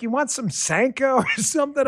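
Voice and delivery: high-pitched, laughing imitation of an old lady; old lady's offer. Elderly hostess offers a visitor coffee, high amused mimicry.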